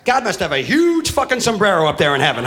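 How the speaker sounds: ordinary talk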